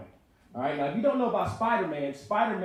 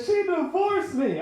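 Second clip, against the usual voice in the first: put-on high, slow, louder sob-talk of a little boy; crying kid voice; 11 semitones higher, 4 dB louder, slower than usual. Little cousin reporting his divorce, high slow wail. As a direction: wail it like a heartbroken child.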